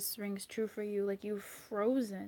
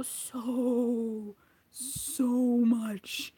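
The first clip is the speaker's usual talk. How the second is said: drawn out; 2 semitones higher, 3 dB louder, much slower than usual. Real, not acted. Dandelions growing fast.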